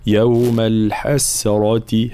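يوم الحسرة slow, with a light clear س and a heavy ر; thin sin, heavy ra. Shown as a demonstration. Teacher models يوم الحسرة slowly with a thin س. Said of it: "هكذا، مع تفخيم الراء".

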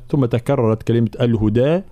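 ordinary talk, nothing marked

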